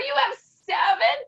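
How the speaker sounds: high, loud incredulous question; retold astonishment. Reacting to friend's seven patches, high loud astonishment. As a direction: echo it back in high, loud disbelief.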